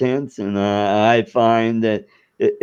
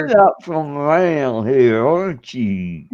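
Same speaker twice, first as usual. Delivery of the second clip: exaggerated Southern accent. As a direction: mimic a local's thick Southern accent. Mimics Southern locals' line.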